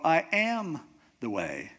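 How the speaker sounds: drawn-out, punched "am"; slow down and lean hard on "am"; stress on AM. Shown as a demonstration. Teacher moving on to the next word, stress on AM. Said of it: "then you can go, after that".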